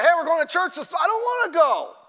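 playing a kid refusing church, half-yelled; whiny kid protest. Kid whining about church.

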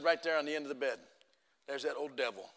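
soft, ominous storyteller's voice; low, quiet retelling of a dread sight; hushed storytelling reveal. Retold or imitated. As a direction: drop it right down for suspense. Suspenseful reveal of the devil.

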